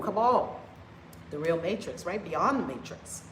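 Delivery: usual speaking voice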